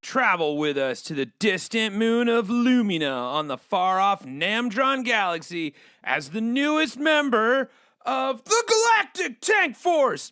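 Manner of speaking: dramatic movie-trailer read; trailer narration. Acted. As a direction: sell it like a sci-fi trailer. Reads the game's promo blurb.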